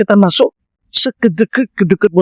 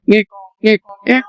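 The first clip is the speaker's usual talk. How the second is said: mock police siren; siren noise; 2 semitones higher than usual. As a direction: squeak out a siren. Imitating a siren.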